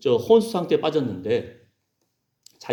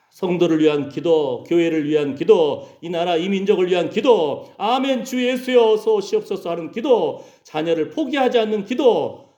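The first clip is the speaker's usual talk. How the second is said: slow, rising litany; insistent, building prayer chant; fervent prayer; 4 semitones higher than usual. Real, not acted. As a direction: cry out each petition with a stretched ending.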